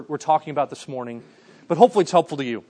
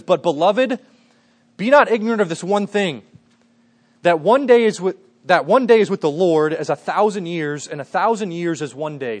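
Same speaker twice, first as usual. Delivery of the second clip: scripture reading; 5 dB louder than usual. Acted. Reads scripture aloud.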